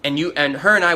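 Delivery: ordinary talk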